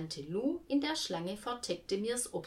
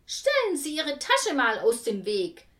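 bossy; 6 semitones higher, 7 dB louder than usual. A narrator's reading. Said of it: "sagte Martha Egge zu Frau Dienstbier".

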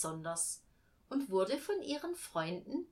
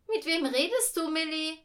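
high, raised-voice question; questioning mother; 9 semitones higher, 7 dB louder than usual. A narrator's reading. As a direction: ask the child in puzzlement, pitched up. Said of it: "fragte die Mutter".

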